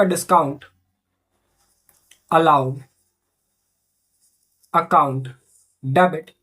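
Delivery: low voice, long pauses between words; word-by-word dictation. Real, not acted. Teacher writing out an entry for students, word-by-word dictation. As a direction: dole out each word separately, pitched low.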